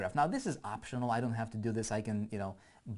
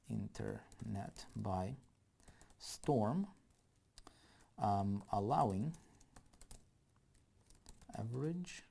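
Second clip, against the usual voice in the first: drawn out word by word, softer, as if typing along; slow, soft dictation of ad copy; 7 dB quieter, slower than usual. Acted. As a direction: spell the copy out slowly while you type it.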